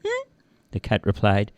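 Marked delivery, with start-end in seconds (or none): 0.0-0.3 very high squeaky voice; high-pitched cartoon mouse; wary little mouse. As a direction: question the cat as a nervous mouse. Mouse questions the cat.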